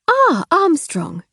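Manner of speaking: high, slow drawn greeting; posh lady voice. Acted. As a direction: greet her slowly from on high.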